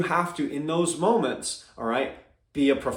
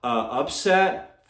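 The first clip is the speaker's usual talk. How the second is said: ordinary talk, nothing marked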